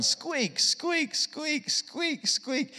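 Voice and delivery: high, soft little squeaks like plastic shoes; playful high squeak sound; squeaky shoe noise. Retold or imitated. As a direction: mimic shoes squeaking with each step.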